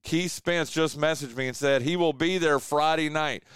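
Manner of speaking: normal talking voice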